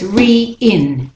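re-in slowly as REE-IN, both vowels separate; E then I. Shown as a demonstration. Teacher showing both vowels, re-in slow as REE-IN. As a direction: keep ee and short i apart in re-in. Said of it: "do the E and then the I".

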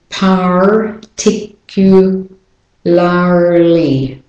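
very slow American "particularly", syllable by syllable, R sounded; American accent. Shown as a demonstration. Teacher shows learners the consonant blends, American-accented slow "particularly". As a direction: break "particularly" apart with American Rs. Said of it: "practicing an American accent".

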